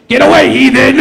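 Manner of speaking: loud, high, hammed-up banishing cry; mock shout. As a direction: cast her out like a preacher, hammed-up. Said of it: "I can just go".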